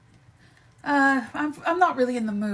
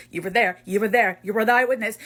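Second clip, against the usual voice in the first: usual speaking voice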